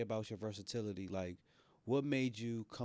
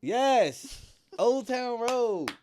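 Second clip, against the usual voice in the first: laughing; 14 semitones higher, 11 dB louder, slower than usual. Real, not acted. Excited recognition with laugh.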